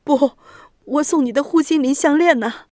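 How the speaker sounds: high, anguished pleading; grief-stricken. A narrator's reading. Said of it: "悲痛地说道".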